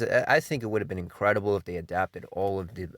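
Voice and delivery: normal talking voice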